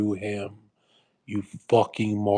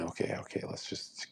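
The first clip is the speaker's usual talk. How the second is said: whispered aside; 14 dB quieter than usual. Real, not acted. Covering an awkward joke to his viewers, whispered. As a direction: drop to a secret whisper.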